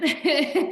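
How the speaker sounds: bright burst of real laughter; delighted laugh. Real, not acted. Laughing with her co-host about the surprise test, real laugh. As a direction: laugh out loud at the memory.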